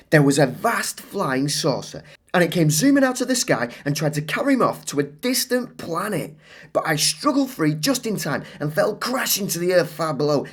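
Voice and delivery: put-on high, excited child telling a wild story; Claire's tall tale. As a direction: spin the tale breathlessly.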